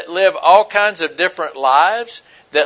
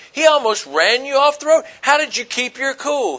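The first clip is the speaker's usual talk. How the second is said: pitched up in disbelief; amazed passenger; 7 semitones higher than usual. Retold or imitated. Passenger asks the calm cab driver, higher and amazed. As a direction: ask like you can't believe he stayed calm.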